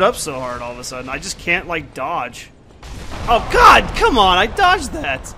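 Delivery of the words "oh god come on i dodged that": loud high protest; outraged shout. Real, not acted. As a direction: yell in disbelief at the game. Gets hit despite dodging.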